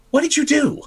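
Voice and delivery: high, sharp accusing cry; shocked outburst. Acted. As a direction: blurt it out in shock at the failed spell. The witch is startled, accusing.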